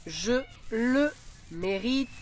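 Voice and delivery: slow, syllable by syllable; slow dictation. Shown as a demonstration. Teacher dictating to pupils, slowly. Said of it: "dictation read slowly".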